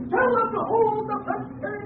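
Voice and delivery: a reporter smelling the story of the century; gleeful, soaring voice, slowing to savour it; excited. Acted. Newsman thrilled by witch story.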